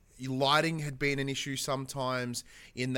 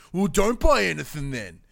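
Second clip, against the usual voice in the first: much louder sharp retort; mock snapping blacksmith; 5 semitones higher, 10 dB louder than usual. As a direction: snap back at the customer. Blacksmith snaps at player.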